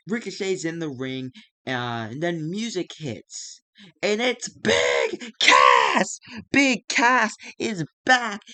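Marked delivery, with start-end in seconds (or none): 4.0-8.4 loud drawn-out shout of the big reveal; slow excited ringside roar; hyped announcer shout. Acted. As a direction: yell it like the crowd just popped. Surprise return reveal.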